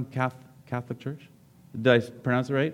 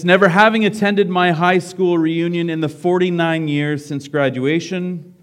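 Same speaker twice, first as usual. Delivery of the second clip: higher, louder read-aloud voice; story reading; 5 semitones higher, 6 dB louder than usual. A narrator's reading. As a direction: read it out to the whole room. Reads a book story aloud.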